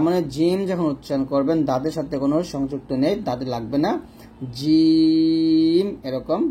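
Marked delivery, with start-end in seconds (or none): 4.6-5.9 জিম held very long, tongue mid-palate; long, firm জিম; drawn-out jim. Shown as a demonstration. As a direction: stretch জিম slowly, tongue off the teeth. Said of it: "জিমের মাখরাজ হলো জিহ্বার মধ্যখান".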